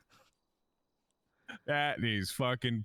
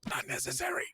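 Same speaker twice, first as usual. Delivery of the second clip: unvoiced whisper. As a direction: whisper it, breathy and light. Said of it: "Stop whispering at me".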